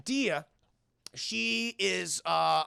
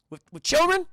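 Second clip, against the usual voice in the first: high, loud incredulous squawk; shocked disbelief; 9 semitones higher, 9 dB louder than usual. Retold or imitated. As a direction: recoil in horror at the idea. Madea horrified at teaching kids.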